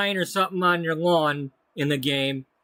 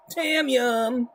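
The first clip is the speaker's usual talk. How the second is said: put-on voice; 7 semitones higher than usual. Repeats the name in a put-on voice.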